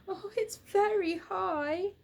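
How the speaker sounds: slow and high, a touch uneasy; nervous little bear. Acted. Little Bear at the cupboard top, mildly nervous. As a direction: peer down from a great height.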